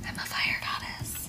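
breathy mysterious whisper. Pretend. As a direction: whisper it like a secret. Whispers the repeat.

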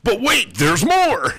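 very high, loud pitchman cry; infomercial. Pretend. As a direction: hype it like a TV salesman. Infomercial catchphrase.

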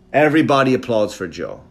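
ordinary delivery

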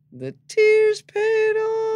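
very high, loud, long-held words; slow sing-song wail to needle someone; mocking taunt. Comedian ribbing friend whose crying won a producer, mocking taunt.

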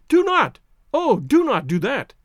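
high, loud, alarmed plea; crying out. A narrator's reading. Woman begs the armed man to stop, crying out. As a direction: plead urgently, slowing on the second appeal. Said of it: "she cried".

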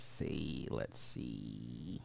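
playful drop to a very low voice; low stalling. Pretend. Streamer looking up the article, deep and drawn-out.

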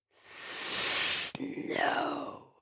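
long hiss into a whispered word; hushed, drawn-out snake voice; hissing snake refusal. Acted. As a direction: refuse in a slow serpent whisper. Snake king hisses a refusal.

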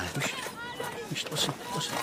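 hushed warning. Acted. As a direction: hush them so you can hear. Hushing the others to listen.

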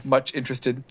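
ordinary delivery

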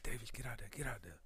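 soft unvoiced whisper; whispered urging. Battle buddies whispering to push Davis forward. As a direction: hiss it to a buddy in formation.

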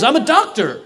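high-pitched, irritated retort; annoyed. Retold or imitated. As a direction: play the doctor put out at being questioned. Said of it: "he's, like, annoyed".

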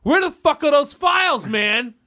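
high, loud demand; imitated irate boss; mock angry boss. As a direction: holler across the office, hammed up. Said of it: "somebody like".

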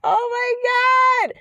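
high, drawn-out disbelief; exasperated oh my God. Real, not acted. Reacting to the cringey podcast line, high exasperated disbelief. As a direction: cry out in cringe.

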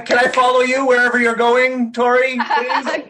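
high, slow, laughing mock begging; mock plea. Actor jokingly begging his co-star to take him along, high and laughing. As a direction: beg her playfully, drawn out and high.

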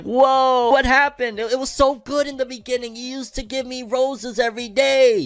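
high whiny hurt lover voice; heartbroken partner. Retold or imitated. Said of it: "you're like".

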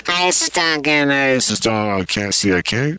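lightly done podcaster impression through a low voice filter; Duncan Trussell. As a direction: greet like a laid-back podcast host. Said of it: "Wait, do Duncan Trussell".

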